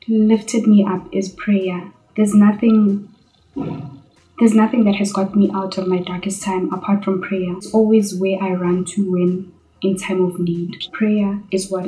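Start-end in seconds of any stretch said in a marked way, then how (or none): none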